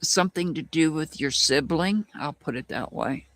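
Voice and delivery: ordinary delivery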